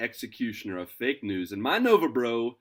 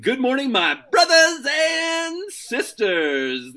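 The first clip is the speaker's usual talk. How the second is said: very high, loud, slow drawn-out shout; booming preacher call; 9 dB louder, much slower than usual. Acted. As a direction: proclaim it like a preacher opening a sermon. Grand opening address to listeners.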